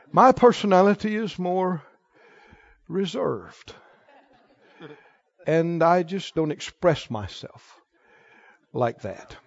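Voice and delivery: mock a reserved churchgoer, voice held down; imitating reserved people. Churchgoer excusing a lack of expression, mock-reserved murmur. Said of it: "Now people say".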